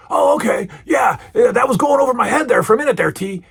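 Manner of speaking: high, loud wrestler impression, speeding up; Hulk Hogan. Hogan finally catching on, to Mister T, loud impression. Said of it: "and then he goes".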